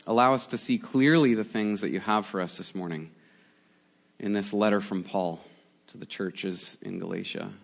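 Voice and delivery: soft, low, unhurried prayer voice; quiet prayer. Acted. Pastor praying softly to open the sermon, hushed and reverent. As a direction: pray gently over the congregation.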